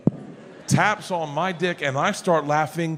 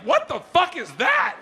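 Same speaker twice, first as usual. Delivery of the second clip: much higher shocked mock voice; mock shocked wife; 12 semitones higher than usual. Wife seeing the video, mock shocked higher voice. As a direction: shriek it, appalled.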